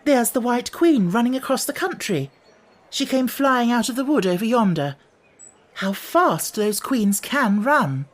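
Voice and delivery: put-on high-pitched eager girl; excited, brightened up. A narrator's reading. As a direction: report a thrilling sight across the country. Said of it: "Suddenly she brightened up".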